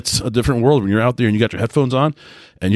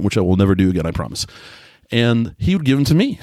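ordinary talk

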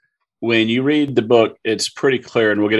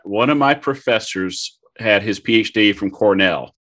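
ordinary talk, nothing marked

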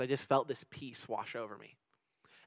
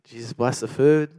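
slow, loud, dazed mumble-shout of a prayer; drowsy nonsense prayer, louder; half-asleep blurt; 10 dB louder than usual. Retold or imitated. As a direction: blurt it out as if dozing off mid-call. Falling asleep on the phone.

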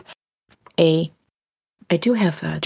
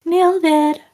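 silly put-on voice; 10 semitones higher, 4 dB louder than usual. Echoes in put-on voice.

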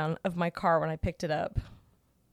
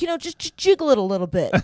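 higher, louder mock voice of the technician; 6 semitones higher, 7 dB louder than usual. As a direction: parrot him with scorn. Mocking the technician's advice.

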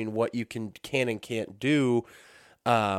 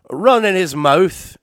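slipped Irish accent; 7 semitones higher, 9 dB louder, slower than usual. Real, not acted. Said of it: "not trying to do an Irish accent".

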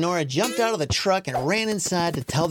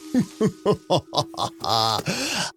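evil chuckle. A narrator's reading. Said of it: "evil-sounding voice".

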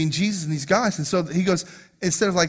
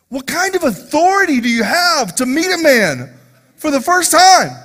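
louder, higher preacher's cry; preaching at full force; 9 semitones higher, 7 dB louder than usual. Real, not acted. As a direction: build it into a loud preaching shout.

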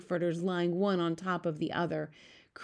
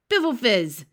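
loud, pitched-up giant's exclamation; shouted scoff; 6 semitones higher, 8 dB louder than usual. A narrator's reading. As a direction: shout it down in contempt. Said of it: "shouted the blood, blood bottler".

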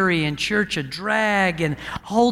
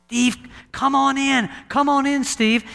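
lightly voiced, higher-pitched beckoning; inviting call; 7 semitones higher than usual. God calls Steve through the open door, inviting. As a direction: call him in with a lifted, welcoming invitation.

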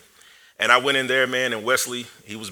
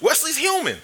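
much higher, thin, almost falsetto; high squeaky repeat. Retold or imitated. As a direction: squeak the discovery out in disbelief. Repeats the realization.

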